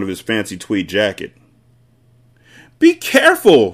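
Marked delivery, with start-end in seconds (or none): 2.8-3.7 very high, loud warning; piercing cry from the mother; shrieking. A narrator's reading. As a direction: shriek it in alarm. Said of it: "shrieked the mother".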